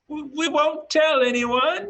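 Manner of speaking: very high, pleading little voice; high-pitched nervous villager; frightened villager. Acted. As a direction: squeak out a timid promise from behind a shutter. Frightened villager pleading through a shutter.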